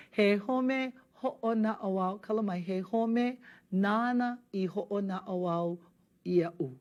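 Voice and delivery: slow, careful Hawaiian line for students to copy; slow model reading. Shown as a demonstration. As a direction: read it slowly so learners can follow. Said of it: "reads line for students to mimic".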